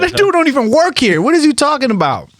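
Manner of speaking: incredulous. Retold or imitated. New firefighter insisting the hanger-on works there, incredulous. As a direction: protest in disbelief. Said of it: "I said".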